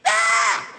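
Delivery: loud raw yell; snapped scream. Acted. Man losing his mind on the bus, loud scream. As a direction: let out a hammed-up battle scream. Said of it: "he snapped".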